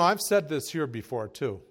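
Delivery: usual speaking voice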